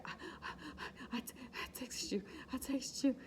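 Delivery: high, breathless and broken, gasping the words out; breathless crying. Retold or imitated. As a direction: stammer it out in high gasps, barely holding together. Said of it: "I'm still out of breath. I'm still hollering. I'm still crying".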